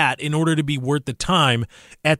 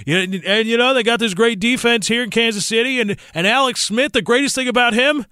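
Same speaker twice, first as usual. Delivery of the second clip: high, upbeat imitation of a TV color commentator; hammed-up booth announcer voice; mock commentator; 8 semitones higher than usual. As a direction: play the cheerful broadcaster praising a game manager. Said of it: "They're gonna say".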